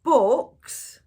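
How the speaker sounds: loud, high puzzled bark; confused. A narrator's reading. Braidbeard baffled by the boy's question, loud confused repeat. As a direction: puzzle over the strange word. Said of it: "Braidbeard looked confused".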